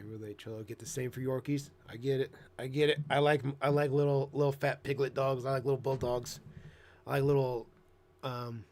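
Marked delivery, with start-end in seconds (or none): none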